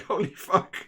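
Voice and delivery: high, loud startled outburst; shocked exclamation. Retold or imitated. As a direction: blurt it out in disbelief. Opponent's shocked reaction, retold.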